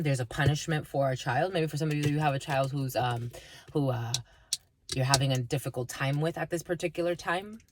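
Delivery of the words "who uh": ordinary talk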